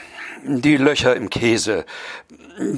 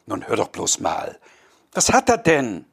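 ordinary talk

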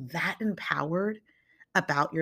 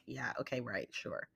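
much softer, lower, dismissive murmur; the sceptical voice in your head, played down quietly; inner doubting voice; 6 semitones lower, 14 dB quieter than usual. Doubting a boss's praise inside your head, quietly dismissive. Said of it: "in your head".

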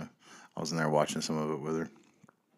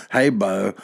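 mock Southern greeting; 2 semitones higher, 9 dB louder than usual. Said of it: "that's how we, we would greet each other".

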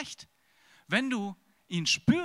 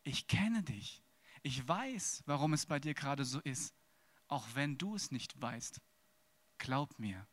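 God's gentle answer; 5 semitones lower, 7 dB quieter than usual. Acted. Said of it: "Und El Roi sagt".

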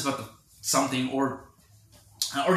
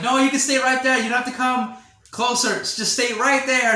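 high, loud call across a distance, half-playful; yelling from far away; 10 semitones higher, 6 dB louder than usual. Retold or imitated. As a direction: call out to someone far off so they stop coming closer. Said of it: "they're, like, yelling at Juke from far away".

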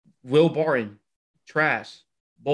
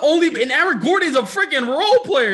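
high, loud retort; heated retort; 12 semitones higher, 6 dB louder than usual. Real, not acted. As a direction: slam the point shut.

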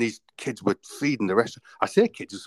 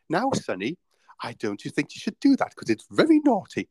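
sing-song, pitched-up nursery reproach; mock gentle scolding; 5 semitones higher than usual. Mock teacher to a tough teenager, patronising gentle scolding. Mocking a soft, prim telling-off.

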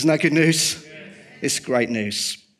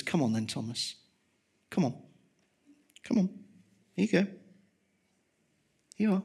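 gentle invitation; 5 semitones higher, 5 dB quieter, much faster than usual. Retold or imitated. Jesus invites doubting Thomas.

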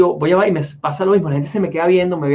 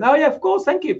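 eager clerk; 10 semitones higher than usual. Retold or imitated. Clerk happily agrees.

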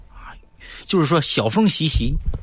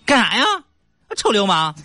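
put-on angry young woman; 9 semitones higher than usual. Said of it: "少妇特别生气".

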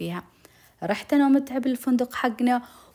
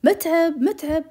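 high, loud call for someone; raised, searching call; 8 semitones higher, 5 dB louder, slower than usual. Retold or imitated. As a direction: call his name out to another room. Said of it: "ونادي يقول".

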